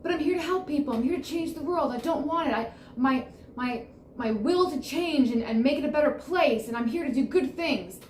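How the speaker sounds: imitating the viewer. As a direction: play back the viewer's earnest objection. Voices the viewer's objection.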